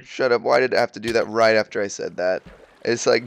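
ordinary delivery

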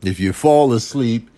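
usual speaking voice

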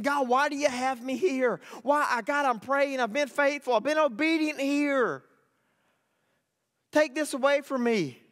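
high, urgent prayer; desperate plea. Acted. Believer praying for a new job, pleading high and urgent.

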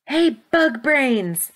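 loud, high, drawn-out call; taunting shout. A narrator's reading. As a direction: holler it to lure them away. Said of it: "Valian shouted".

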